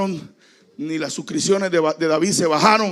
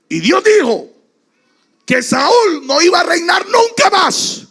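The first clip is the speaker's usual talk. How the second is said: preaching cry; 14 semitones higher, 10 dB louder than usual. Acted. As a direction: cry it out to the whole church. Preacher crying out climax.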